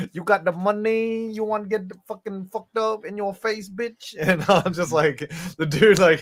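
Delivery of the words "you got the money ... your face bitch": put-on Vietnamese accent. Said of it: "perfect Vietnamese accent".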